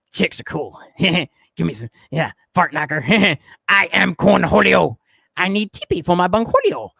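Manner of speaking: snickering cartoon teen voice with giggles; Beavis impression. Chat asked for a Beavis impression, doing it with snickers. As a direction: do a goofy Beavis with laughs in it. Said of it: "Beavis impression?".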